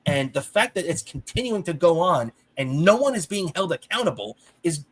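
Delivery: ordinary delivery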